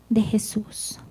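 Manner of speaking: trailing off into a breathless whisper; hushed, reverent whisper. Acted. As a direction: drop to a whisper on the name. Hushed end of exhortation.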